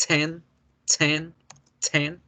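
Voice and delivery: "Ten" with a hard aspirated T; strong T. Shown as a demonstration. Teacher repeating ten with a hard T. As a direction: hit the T in "Ten". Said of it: "hago fuerza en el, en la T".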